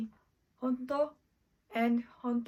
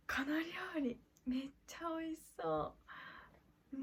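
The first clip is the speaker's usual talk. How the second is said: whisper; 4 semitones higher, 6 dB quieter, much faster than usual. Acted. Friends eyeing tasty food.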